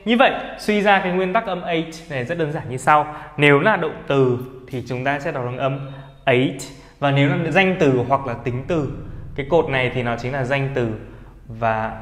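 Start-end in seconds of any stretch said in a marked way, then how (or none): none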